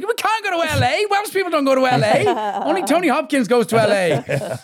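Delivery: high, mock-outraged disbelief; mock incredulous. Welsh actor scoffs at friend moving to LA. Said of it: "It's like".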